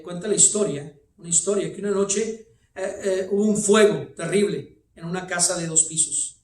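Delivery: normal talking voice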